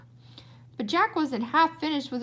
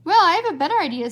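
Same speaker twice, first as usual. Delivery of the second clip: eager stranger; 5 semitones higher, 7 dB louder, faster than usual. Acted.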